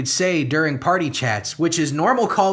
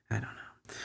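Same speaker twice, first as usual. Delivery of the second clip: much softer, barely voiced; near-silent murmur; 8 semitones lower, 18 dB quieter than usual. Real, not acted. Giving up on the lesson, a near-silent resigned murmur. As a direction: let it fade away to nothing.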